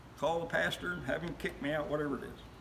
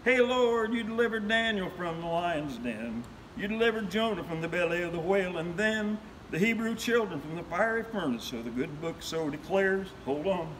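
rhythmic storyteller's recital with lively swings; folksy poem recitation; 3 semitones higher, 3 dB louder than usual. Acted. Reciting the preacher poem to friends, rhythmic and lively. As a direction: perform the old preacher ballad for listeners. Recites his added covid verse.

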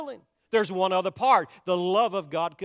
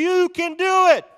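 rallying shout; 9 semitones higher, 9 dB louder than usual. Real, not acted. Preacher cheering on the congregation, rallying shout. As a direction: shout it to rouse the church.

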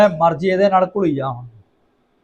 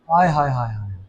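ਆਏ ਹਾਏ savouring; 6 semitones lower, 4 dB quieter than usual. Real, not acted. Savouring the noodle aroma. (ਖੁਸ਼ਬੂ ਦਾ ਮਜ਼ਾ ਲੈਂਦਿਆਂ ਆਏ ਹਾਏ)

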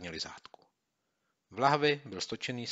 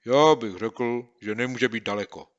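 put-on musing boy; 2 semitones higher, 10 dB louder than usual. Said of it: "uvažoval Kopyto".

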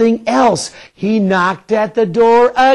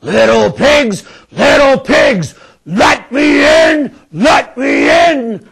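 big bad wolf calling out, rising louder; 6 semitones higher, 3 dB louder than usual. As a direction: call through the door, louder each time. Wolf calls at the door.

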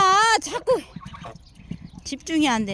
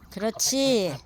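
ordinary talk

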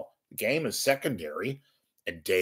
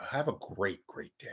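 ordinary talk

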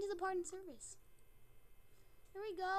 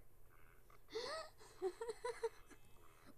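bursts of higher-pitched laughter; laughing; 5 semitones higher, 7 dB quieter than usual. Real, not acted. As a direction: break into giggles. Laughing at own exclamation.

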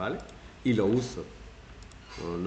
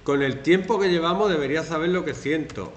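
reproachful partner; 5 semitones higher, 5 dB louder than usual. Retold or imitated. Manipulative partner quote.

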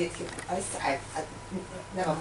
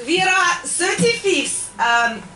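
put-on Russian accent; 4 semitones higher than usual. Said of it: "KGB comedy act".

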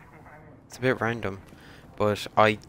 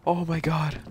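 shocked gasp. Real, not acted. Machine starts, gasping shock.

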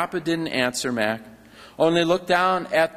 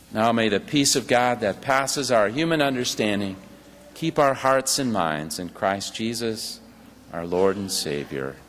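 benediction; 6 semitones lower than usual. Acted. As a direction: bless the congregation to close. Closing benediction.

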